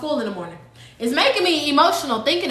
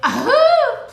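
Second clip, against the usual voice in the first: high burst of laughter; real laugh at her own jail prayer; loud laugh; 11 semitones higher, 4 dB louder than usual. Real, not acted. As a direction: crack up out loud.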